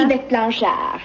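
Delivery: said the French way, YVETTE stressed, falling; French name. Acted. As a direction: give the name with a French lilt. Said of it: "Your name is, uh".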